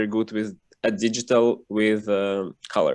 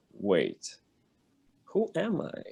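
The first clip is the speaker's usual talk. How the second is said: hushed self-questioning; 7 dB quieter, slower than usual. Retold or imitated. Retold moment of self-doubt.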